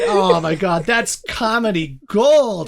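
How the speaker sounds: usual speaking voice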